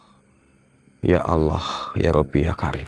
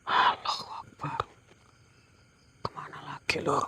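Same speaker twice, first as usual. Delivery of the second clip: breathless hushed whisper, wary of the spirit; tense whisper; 7 semitones higher, 12 dB quieter than usual. Real, not acted. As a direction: whisper it as if something might hear. Hushed ghost hunt at night.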